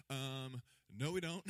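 a flat no, amused; incredulous Thomas. Retold or imitated. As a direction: Thomas blurting that they have no idea. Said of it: "And Thomas is like".

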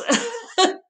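a bright, loud giggle; loud high laugh. Real, not acted. Laughing at her own croissant plan, a loud high giggle.

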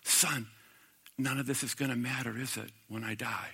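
soft, fading to almost nothing; dying father's quiet words. Retold or imitated. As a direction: let the old man's question drift away quietly. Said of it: "and he goes".

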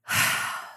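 heavy breathy sigh. Retold or imitated. Newcomer trying to calm down, sighing. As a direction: let out a long breath. Steadying herself in the retelling.

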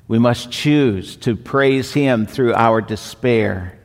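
slow, weighty lines with "choose" stressed. Shown as a demonstration. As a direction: lean hard on "choose" each time, drawn out. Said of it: "And I keep emphasizing choose".

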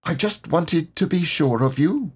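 small, high animal voice; Piglet. A narrator's reading. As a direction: confide it in a little high voice. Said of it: "said Piglet".

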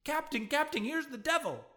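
high panicked shout; panicked yell. A narrator's reading. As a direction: shriek it like you've seen the devil. Bumpkin runs yelling to officer.

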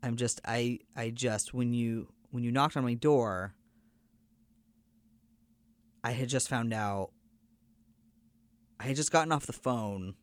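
halting retold confession, long pauses; subdued, hesitant stammer; slight stutter. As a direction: falter and restart, searching for the words. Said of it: "starts to stutter a little bit".

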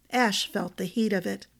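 ordinary delivery, nothing marked

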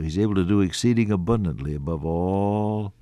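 ordinary talk

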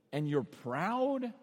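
slow, disbelieving question; low, stretched-out disbelief; incredulous sarcasm. Acted. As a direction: draw out the scornful question. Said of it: "it's just like sarcasm after sarcasm".

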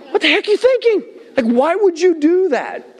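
very high, exasperated mock scolding; irritated. Father to little boy who cut flowers, irritated mock scolding. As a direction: pitch way up, stretching out the baffled question. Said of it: "I got so irritated".

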